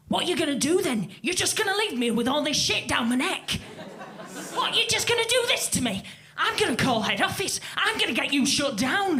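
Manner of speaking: imitating an angry northern man ranting; mock-furious complaint, fast and pushed. Imitating the angry northern man complaining to her backstage, fast and ranting. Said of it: "very angry".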